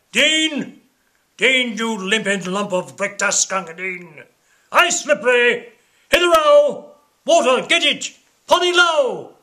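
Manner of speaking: high, loud barrack-room yells; shouting impatiently across the camp; soldiers bawling. Acted. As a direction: bellow orders at the water boy. Soldiers bawling for the water-carrier.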